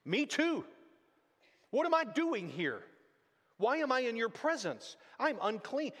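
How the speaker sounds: a touch of desperate prophet; anguished Isaiah. Retold or imitated. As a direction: voice Isaiah's dismay, lifted high. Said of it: "Isaiah is saying".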